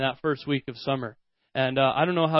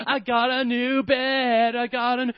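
put-on a small girl who can't believe her luck; excited little girl; 5 semitones higher than usual. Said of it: "she's jumping the whole time".

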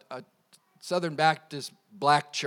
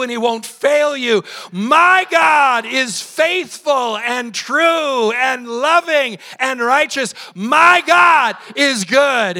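loud, rousing call and response; full-force preaching; 9 semitones higher, 13 dB louder, slower than usual. Real, not acted. Pastor leading the congregation's declarations, full-force preaching. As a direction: shout each opening, drawing out the key words.

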